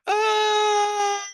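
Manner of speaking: high, loud imitated wail of a crying kid; imitating a first grader screaming in pain; child's scream. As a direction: let out the boy's hysterical scream. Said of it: "And this is what happened".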